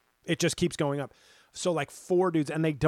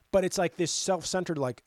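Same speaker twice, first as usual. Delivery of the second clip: usual speaking voice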